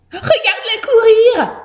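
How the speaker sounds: high, thrilled mouse squeal; amazed exclamation. A narrator's reading. Mouse marvelling at the hamster's feat, exclaiming. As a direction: exclaim in high-pitched wonder. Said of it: "s'exclame Josette".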